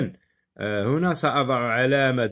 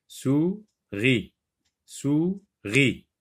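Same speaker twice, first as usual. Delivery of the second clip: "Souris" as two separate beats, sou, ri; split into syllables; 3 semitones higher, much slower than usual. Shown as a demonstration. Teacher locates the R syllable, "Souris" split sou - ri. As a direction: break "Souris" with a clear pause before "ri". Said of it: "dans la deuxième syllabe".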